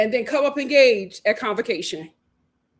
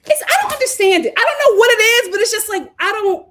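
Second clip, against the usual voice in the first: baffled outburst; 9 semitones higher, 7 dB louder, faster than usual. Real, not acted. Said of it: "baffled outburst".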